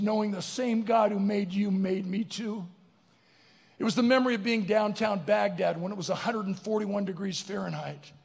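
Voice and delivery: ordinary delivery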